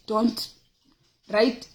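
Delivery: ordinary talk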